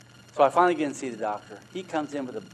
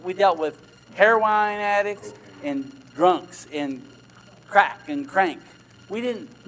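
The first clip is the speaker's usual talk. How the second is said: louder and higher, driving hard at the congregation; fired-up preaching; 6 semitones higher, 6 dB louder than usual. Acted. Pastor stirring his congregation, raised preaching voice. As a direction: preach it at full force, pressing each point home.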